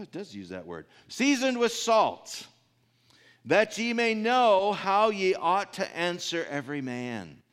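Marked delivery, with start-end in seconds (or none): 1.1-7.4 slow, high, solemn Bible reading; scripture reading. Acted. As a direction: read the verse out slowly to the flock. Reads scripture aloud.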